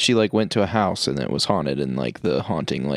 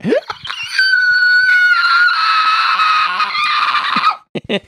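long, high, loud screech; imitation of a Body Snatchers scream, held long; 6 dB louder than usual. Shown as a demonstration. Friend suggesting a prank-call noise, screeching like Body Snatchers. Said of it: "screamed like the Body Snatchers".

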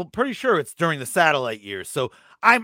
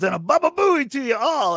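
high shout; 10 semitones higher than usual. Retold or imitated. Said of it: "he goes".

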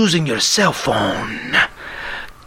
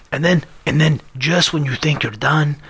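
normal talking voice

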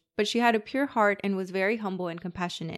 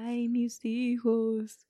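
half-hearted, slow ghostly cry; a timid try at the wail; shy weeping ghost woman; 3 semitones higher, much slower than usual. Acted. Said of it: "I was shy with that part".

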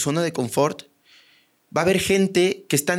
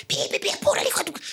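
high honking, breathy rush; Mexico City driver honking at you; mock city driver; 3 dB quieter, much faster than usual. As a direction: honk and curse like an impatient driver.

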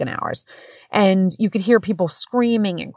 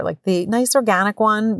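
ordinary talk, nothing marked

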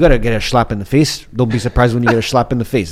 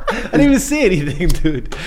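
laughing protest; 4 semitones higher than usual. Real, not acted. Laughing at friend's reaction.

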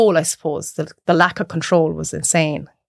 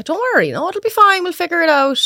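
high, bright mock reassurance; forced reassurance; 10 semitones higher than usual. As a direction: sound chirpy to calm a panicking client. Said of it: "It was like".

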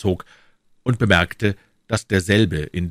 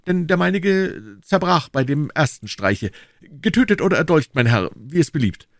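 much higher, polished courtier's voice; higher, gentle, dandyish soldier; refined musketeer; 10 semitones higher, 4 dB louder than usual. Acted. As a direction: Aramis boasting elegantly. Aramis continues.

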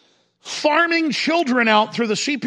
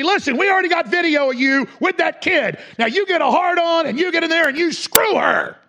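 menacing handler; 8 semitones higher than usual. Retold or imitated. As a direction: bark the order like a cruel captor. Voicing the abusers threatening couples.

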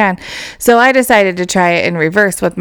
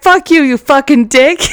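retold loud talk; 6 semitones higher, 4 dB louder than usual. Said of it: "doesn't realize how loud he's talking".

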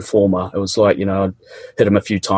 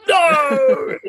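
desperate cry. Retold or imitated. Worn-out student refusing to give up, high desperate cry. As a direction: cry out against it in despair. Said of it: "it's like".

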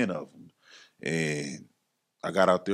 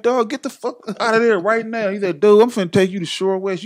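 higher, louder mock orders; urgent command; 13 semitones higher, 6 dB louder than usual. Johnny ordering his friend out of the temp service, high and urgent. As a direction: insist he leave right now.

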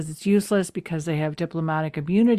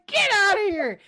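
shooing him out; 11 semitones higher than usual. Retold or imitated. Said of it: "She's like".

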